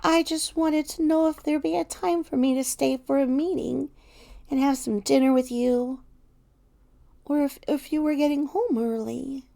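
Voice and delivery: small, high Teddy voice; tiny voice. A narrator's reading. Teddy timidly asks about dinner, in a tiny voice. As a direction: make yourself tiny and timid in asking. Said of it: "In the tiniest of voices".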